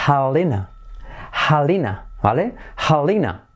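HELENA as "HA-lee-na", breathed H, first syllable stressed; correct English pronunciation. Shown as a demonstration. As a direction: model HELENA like "gallina" with an English H. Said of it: "Se dice".

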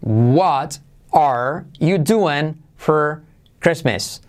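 "What are you doing for Christmas" very slowly, each word apart. Shown as a demonstration. Word by word, then linked. («What are you doing for Christmas» lento, palabra por palabra)

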